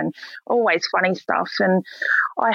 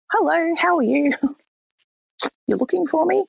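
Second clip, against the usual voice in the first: high, sing-song breezy greeting with a laugh; mock innocent cheer; 11 semitones higher than usual. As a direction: play it far too cheerful for a police station.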